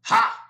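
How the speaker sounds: high, very loud barking laugh; loud scornful ha. A narrator's reading. Registrar scoffs at Will's answer, very loud. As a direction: bark one scornful syllable. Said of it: "Sam said".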